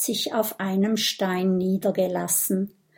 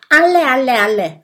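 loud, higher insistent repeats; emphatic assurance; 4 semitones higher, 9 dB louder, much faster than usual. A narrator's reading. Minimo assures the friends that all dragons were there, insistent. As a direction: assure them with full force. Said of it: "versichert Minimo".

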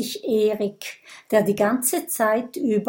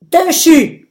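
loud, high shouted insult; shouting; 7 semitones higher, 11 dB louder than usual. Acted. Brodier shouting an insult at Gudröd, loud and high. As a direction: fling the word out loud. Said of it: "ruft Brodier".

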